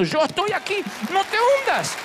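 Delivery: high, ringing shout; loud call. Acted. Jesus shouting to Peter not to sink. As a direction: shout it across the water. Jesus calls from the other side.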